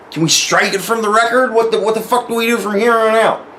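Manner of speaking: high, agitated retold complaint; exasperated appeal. Player asking Dennis to strike it, exasperated.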